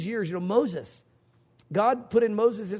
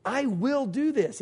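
"I will do this" slow and higher, word by word; slow, raised, deliberate promise on "I will do this"; emphatic vow; 4 semitones higher, slower than usual. Acted. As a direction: lean slowly on each word of "I will do this". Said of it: "it's, it's emphatic".

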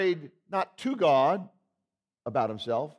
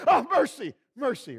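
mock defeated cry of mercy, rising high then fading; hammed-up plea of a kid losing a game; giving up; 10 semitones higher, 3 dB louder than usual. Kid losing the Mercy game, hammed-up plea. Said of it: "you gave up and said".

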